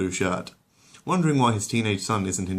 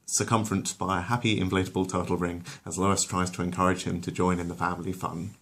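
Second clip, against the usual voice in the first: ordinary delivery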